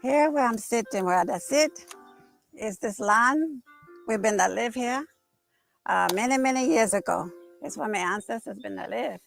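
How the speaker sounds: lowcountry creole accent. Shown as a demonstration. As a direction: speak in the old Seminole creole accent. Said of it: "It was English, but it was different".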